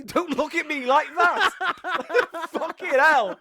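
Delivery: high-pitched, helpless laughing protest. Real, not acted. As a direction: crack up and squeal it through laughter. Cracking up during rules explanation.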